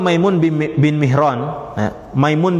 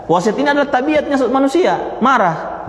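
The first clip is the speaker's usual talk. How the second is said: higher, protesting voice of the man; raised-voice objection; mock protesting man; 9 semitones higher than usual. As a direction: protest that anger is only human. Voicing the man's objection.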